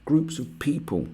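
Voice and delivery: high prissy mock voice; mock sanctimonious do-gooder voice; mocking flouncing do-gooder. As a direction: lampoon a preachy campaigner. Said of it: "flounce around saying".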